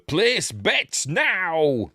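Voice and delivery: loud, drawn-out imitation Chinese accent; high, very slow announcer in a mock Chinese accent; Bert Kwok. Game-show voiceover calls for bets, imitated Chinese accent. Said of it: "in, uh, his Chinese accent".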